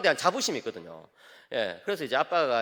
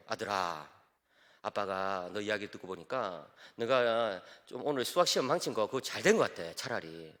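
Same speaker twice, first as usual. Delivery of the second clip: low, soft earnest father; serious fatherly talk; 7 semitones lower, 5 dB quieter than usual. Retold or imitated. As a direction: talk gently and seriously to the son. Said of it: "진지한 이야기를 했죠". (아들에게 진지하게 말하는 아빠)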